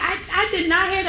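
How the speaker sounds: indignant denial. Retold or imitated.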